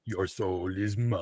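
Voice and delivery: low, slow, menacing villain line; Shang Tsung voice. Retold or imitated. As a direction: drawl it out like a sorcerer. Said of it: "as he said in the Mortal Kombat movie".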